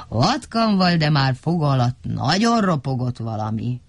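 ordinary delivery, nothing marked

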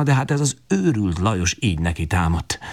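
plain reading voice

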